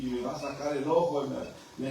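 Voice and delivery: ordinary delivery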